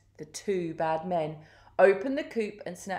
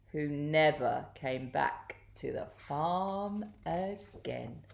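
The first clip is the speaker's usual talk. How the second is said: slow, lower, softer narration; slow story ending; 4 semitones lower, 6 dB quieter, slower than usual. A narrator's reading. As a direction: draw it out with quiet finality. Narrator closes the villains' fate.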